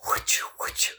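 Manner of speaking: soft, breath-only whooshing noise; whispered whoosh. Shown as a demonstration. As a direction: imitate a whoosh with breath alone. Imitates a noise mid-sentence.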